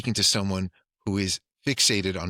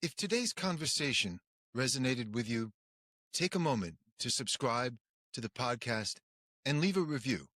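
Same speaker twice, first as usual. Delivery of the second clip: soft, higher announcer read; podcast outro; 4 semitones higher, 8 dB quieter than usual. Acted. Host to listeners, a soft announcer read.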